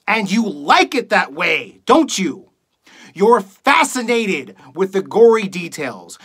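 emphatic, acted doom-laden tirade; accusing rant. Radio host tells audience the world is doomed, accusing rant. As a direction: hammer each accusation at the audience. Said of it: "reading a monologue for you guys".

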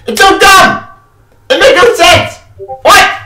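loud, high, exasperated shout; angry outburst. Real, not acted. Gamer ranting at the ending, furious shout. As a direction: yell it out in disbelief at the twist.

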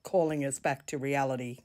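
ordinary delivery

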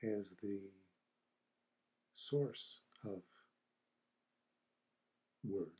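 unhurried meditation guide, long silences between phrases; guided meditation. Acted. Teacher guiding listeners to feel the silence after the chime, calm meditation voice. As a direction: ease listeners into stillness, leaving space after each phrase.

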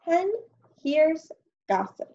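lively storybook read-aloud with character calls; teacher reading a farm story to her class, lively; reading to children. Acted. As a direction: read it like a picture book to a class.

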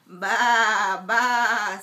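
loud bleating ба ба; lamb bleat. Acted. Parent reading to child, loud lamb bleating. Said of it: "says the барашек".

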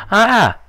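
loud, high yelp of alarm; sharp panicked shriek; startled scream. Real, not acted. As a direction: scream out in sudden fright. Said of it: "just had a heart attack".

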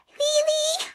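high, disbelieving Really; exasperated surprise. Real, not acted. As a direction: groan at the hiccup coming back. Hiccups returned.

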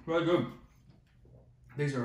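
talking with food in mouth; muffled words between bites; chewing while talking. Real, not acted. Seen 2: Fries just bitten at -5 to -3; jaw working, mouth closed.

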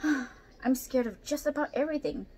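lightly voiced nervous orangutan; timid orangutan. A narrator's reading. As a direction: speak as a shy, fearful orangutan. Timid orangutan confesses fears.

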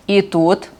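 ordinary delivery, nothing marked

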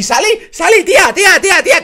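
high, loud exasperated cry at Sally; scolding shout. Real, not acted. Youtuber yelling at Sally on screen, exasperated. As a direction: yell at the cartoon in disbelief.

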